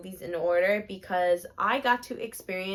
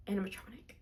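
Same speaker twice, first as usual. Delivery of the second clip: animatronic breathed soft in a whisper; awed whisper; 13 dB quieter, faster than usual. Real, not acted. Telling viewers about the Walt robot, whispered in awe. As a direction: hush the word in awe.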